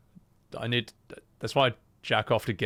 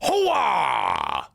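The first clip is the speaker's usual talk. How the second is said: Al Pacino hooah. Shown as a demonstration. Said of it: "he goes".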